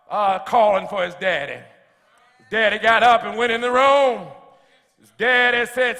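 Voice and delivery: ordinary talk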